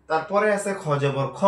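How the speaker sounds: usual speaking voice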